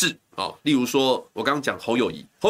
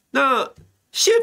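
voice cracking; 12 semitones higher, 4 dB louder than usual. Real, not acted. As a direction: voice cracks on a high note. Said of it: "破音，哦，喝个水". (破音)

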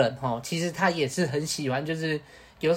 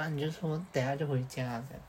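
hushed, much softer aside to a friend; quiet aside; 10 dB quieter than usual. Retold or imitated. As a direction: murmur it so his mother can't hear. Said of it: "我就小声的跟他讲说". (小声偷偷说)

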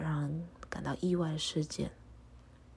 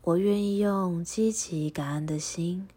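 prayer recitation. Acted. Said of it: "请和我一起复诵一下祈祷文".

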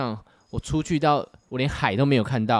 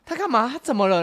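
high, loud alarmed questions; retold shock, pitched up; startled friend; 10 semitones higher, 7 dB louder than usual. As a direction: imitate a friend taken aback. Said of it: "我朋友整个吓到".

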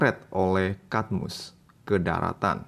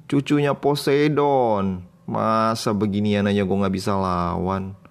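cocky bragging; 5 semitones higher, 3 dB louder than usual. Acted. Hero bragging to Harmonia.

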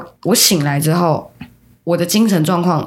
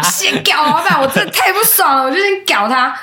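annoyed; 10 semitones higher than usual. Real, not acted.

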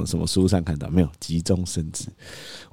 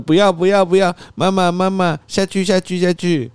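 much higher, whiny refusal; imitating toddler; 10 semitones higher, 3 dB louder than usual. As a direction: protest like a toddler. 模仿孩子抗拒. (高音模仿小孩哭闹)

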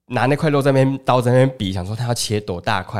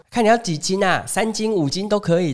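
pushy market auntie; 8 semitones higher than usual. Retold or imitated. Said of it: "那阿姨就说".

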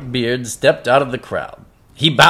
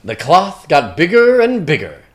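ordinary delivery, nothing marked

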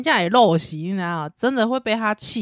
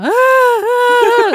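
long drawn-out high mock wail, loud; hammed-up toddler crying, stretched slowly; imitated toddler wail; 10 dB louder, much slower than usual. Mother imitating her crying toddler, mock wail.

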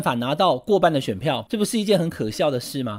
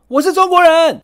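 high, loud mock yell; imitated street shout; 12 semitones higher, 11 dB louder than usual. Someone yelling on a Ximending street, hammed-up loud shout. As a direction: belt it out like a man shouting in the street. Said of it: "在台北的西门町街头高喊说".

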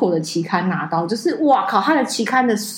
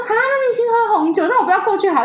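much higher mock sulk; sulky professor; 11 semitones higher, faster than usual. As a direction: play him pouting like a child. Retelling professor sulking.

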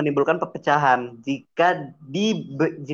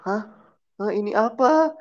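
confused; 6 semitones higher than usual. Retold or imitated. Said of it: "kayak orang".

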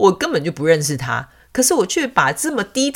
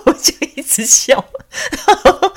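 high, louder laughter; laughing; 14 semitones higher, 6 dB louder than usual. Real, not acted. Amused narrator laughing at the cat's stubborn dad. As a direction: laugh through the words.

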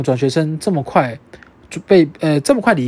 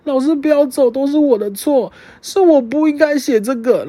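mock pleading; 6 semitones higher than usual. Kneeling, begging the teacher.

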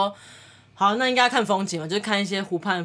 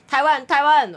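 imitating an excited boat guide, raised and louder; mock cheer; 5 semitones higher, 6 dB louder than usual. Boatwoman cheering at the Taiwanese tourists, mock excited.

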